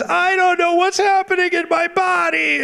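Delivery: very high, slow impersonation of an overwhelmed woman; mock hysterical high voice; screaming lady. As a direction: wail it high, like a startled lady. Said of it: "a lady screams in the back".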